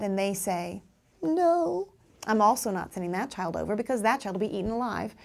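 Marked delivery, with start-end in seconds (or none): 1.2-1.9 put-on upset child's no. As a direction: blurt no like a hurt child. Teacher voicing upset child.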